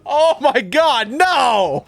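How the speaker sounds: high, loud, slow outcry; Oh my God, no, drawn out in shock; shocked groan. Real, not acted. Groan after bad joke.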